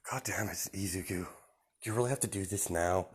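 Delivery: exasperated under breath. Retold or imitated.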